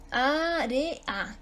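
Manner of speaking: "área" sounded out as á-re-a; teacher's slow split of "área"; separated syllables. Shown as a demonstration. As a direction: break "área" into three beats, e and a apart. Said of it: "E A son dos vocales fuertes".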